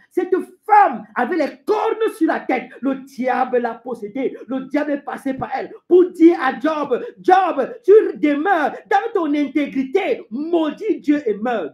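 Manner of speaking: high, emphatic, drawn-out denunciation; forceful preaching. Acted. Preacher denouncing Job's wife as devil's mouthpiece, forceful. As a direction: stretch the words and drive them home.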